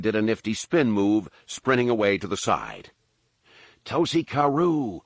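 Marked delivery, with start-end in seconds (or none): 3.8-5.0 slow, high called-out name; yell after him. A narrator's reading. Han calling after a fleeing local, slow high yell. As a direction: call it after the fleeing man. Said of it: "Han yelled after him".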